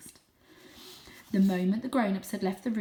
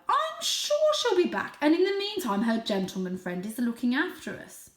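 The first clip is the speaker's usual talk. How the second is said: father cat voice; 6 semitones higher, 5 dB louder than usual. Acted. Father cat reassures kittens.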